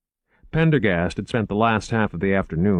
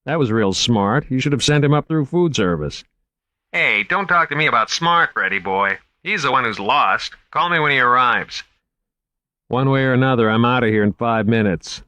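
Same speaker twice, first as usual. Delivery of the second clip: lightly voiced gruff guard, raised; guard snapping over the radio; a hint of an irritated guard; security guard on radio; 5 semitones higher, 4 dB louder than usual. A narrator's reading. Beauregard on the radio.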